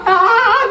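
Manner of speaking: sung-out desperate "Ah"; a high, sing-song groan of need; strained groan. Acted. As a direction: moan like you can't hold it. About to burst, bathroom.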